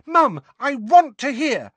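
put-on high, loud childish whine; piercing pleading girl; wail. A narrator's reading. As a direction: wail in protest to mother. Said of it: "wailed Ginny".

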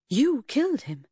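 high, loud shocked question. A narrator's reading. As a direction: blurt it out in disbelief. Narrator shocked at confession.

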